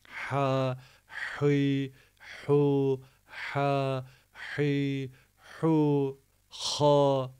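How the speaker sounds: "Ha, hi, hu" with a rasped throat h; raspy h. Shown as a demonstration. Teacher demonstrating the raspy throat h to students. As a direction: scrape the h in the throat. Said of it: "hırıltılı".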